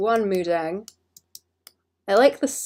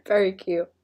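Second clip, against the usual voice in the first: ordinary talk, nothing marked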